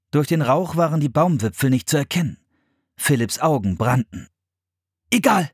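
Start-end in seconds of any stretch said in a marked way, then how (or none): 5.1-5.5 high, decisive dismissal. A narrator's reading. Philipp giving up on directions, decisive. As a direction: brush the doubt aside. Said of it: "entschied er".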